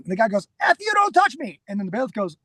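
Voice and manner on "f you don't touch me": retold outburst, pitched way up; imitated angry yelling. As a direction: imitate a man losing it, voice jumping up high. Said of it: "all he was doing was yelling".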